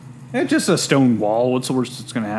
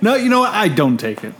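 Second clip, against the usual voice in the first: tag; 6 semitones higher, 3 dB louder than usual. Acted. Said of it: "It's like".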